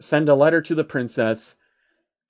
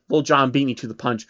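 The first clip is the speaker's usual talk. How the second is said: plain reading voice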